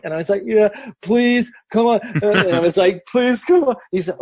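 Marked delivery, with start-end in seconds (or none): 0.5-2.0 high-pitched mock begging; desperate pleading. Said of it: "kind of at wit's end". 3.1-3.8 high-pitched mock begging; desperate pleading. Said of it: "kind of at wit's end".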